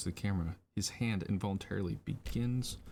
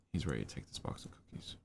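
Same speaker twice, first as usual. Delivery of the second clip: hushed breathy whisper, building tension; suspense whisper; 4 semitones lower, 5 dB quieter than usual. Acted. Narrating a kid sneaking cookies, in a tense whisper. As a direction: whisper it like a heist is starting.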